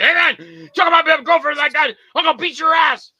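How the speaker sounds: high, loud acted shouting; angry threat. Boyfriend grabs the goofy guy over his girlfriend, angry shouting. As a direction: blow up at the guy, yelling.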